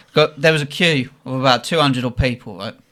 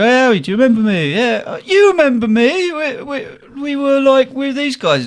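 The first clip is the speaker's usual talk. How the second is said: high, eager fanboy voice; imitating pestering fans; 10 semitones higher than usual. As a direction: pester him in a mock high-pitched voice. Voicing fans pestering the doorman.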